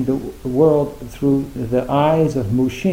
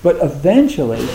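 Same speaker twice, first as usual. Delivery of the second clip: ordinary delivery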